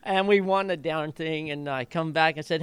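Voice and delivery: ordinary talk, nothing marked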